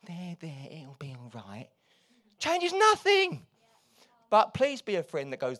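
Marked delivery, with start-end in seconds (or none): 0.1-1.7 soft, hushed "there, there"; mock comforting. Friend mockingly soothing someone, soft and hushed. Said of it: "someone going". 2.4-3.5 slow, very high, loud retort; emphatic. Acted. Preacher dismissing empty comfort, slow and emphatic. Punchline after mock comfort.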